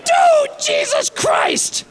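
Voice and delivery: high, loud yelp of pain; mock agony, shrieking high; pained yelling. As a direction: scream like the needle just went in. Needle stabbed into his arm.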